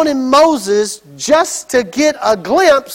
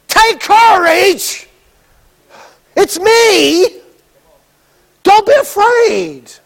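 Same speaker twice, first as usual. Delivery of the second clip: higher, slow, lively cheer-up; excited encouragement; 7 semitones higher, much slower than usual. Retold or imitated. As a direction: call out bright and eager. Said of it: "No, no".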